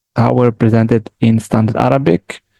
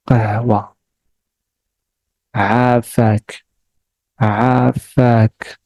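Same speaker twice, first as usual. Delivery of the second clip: each word slow and separate, with pauses; slow repetition. Shown as a demonstration. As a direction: say each word slowly twice. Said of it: "I will repeat it slowly and then fast".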